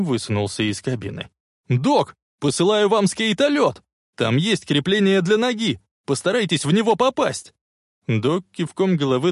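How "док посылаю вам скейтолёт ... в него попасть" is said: high, urgent calling; shouted instructions. A narrator's reading. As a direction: shout directions across a distance. (громкие указания издалека)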